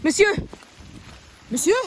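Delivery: loud call across the forest. Acted. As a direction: call out to him from afar. Calling to man in forest.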